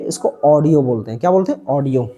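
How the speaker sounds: ऑडियो said with an open "aw", aw-dio; correct "audio". Shown as a demonstration. Teacher corrects village "edio" to "aw-dio". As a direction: start ऑडियो with a rounded "aw", not "e". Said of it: "इसको एडियो नहीं".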